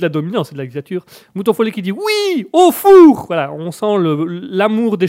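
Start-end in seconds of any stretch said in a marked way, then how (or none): 1.9-3.3 high-pitched loud cheer; gleeful shout. Acted. Viewer wanting the cat in the oven, high gleeful shout. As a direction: squeal it with mock glee. Lit le message enthousiaste contre le chat.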